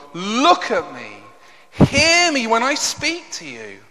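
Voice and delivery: insistent near-shout, acted; almost shouting. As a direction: cry out to God to be heard. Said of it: "almost shouting to God".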